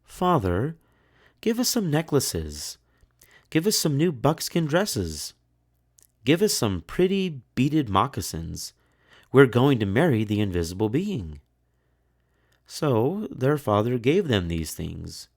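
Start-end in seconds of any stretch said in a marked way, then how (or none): 0.0-11.4 high, whiny demanding voice; spoiled daughters. A narrator's reading. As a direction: beg father like greedy girls. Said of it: "went to their father and said".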